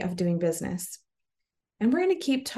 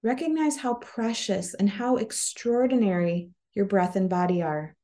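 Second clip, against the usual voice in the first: guided meditation. Acted. Podcast host guiding listeners to cultivate joy, calm meditation voice. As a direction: ease them gently into breath and body, unhurried.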